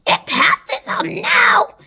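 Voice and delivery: high, squawky yell; high-pitched exasperated shout. A narrator's reading. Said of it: "Anne Spiker yelled".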